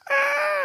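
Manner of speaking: very high-pitched sustained scream; retold scream. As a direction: imitate a terrified child's shriek. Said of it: "I would scream so loud".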